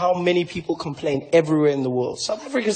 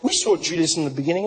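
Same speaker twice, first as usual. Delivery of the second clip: ordinary talk, nothing marked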